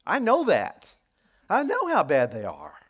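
loud retort, high at first then dropping; acted indignant "I already know"; exasperated protest. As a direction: push back at God as the frustrated prophet. Voices prophet protesting to God.

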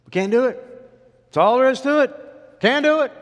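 high, loud protest; defeatist outcry. Acted. The people siding with the ten spies, defeatist outcry. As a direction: cry out that it's hopeless.

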